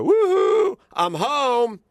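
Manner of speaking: very high, loud whooping call; mock grandmother call. Grandmother announcing her arrival home, high and loud. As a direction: whoop it out like a cheery hail.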